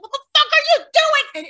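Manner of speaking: mock-shriek of Rhea's shock; outraged Rhea. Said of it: "Rhea's like".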